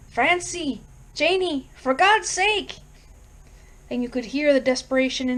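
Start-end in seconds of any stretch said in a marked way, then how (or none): 0.1-2.8 higher, slow, anguished calling; desperate scream. A narrator's reading. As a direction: scream their names, pleading with God. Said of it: "screamed into the night".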